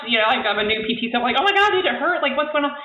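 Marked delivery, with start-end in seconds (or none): 1.4-2.7 high-pitched, worried questioning; mock-panicked check on someone who may be hurt; retold panic. New physical therapist asks crying patient if it hurt, retold panic.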